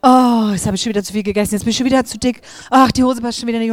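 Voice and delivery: fast, loud whiny complaining; mock a friend moaning about her weight; nagging friend. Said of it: "wenn deine Freundin immer meckert". (schnelles, lautes Jammern der nörgelnden Freundin)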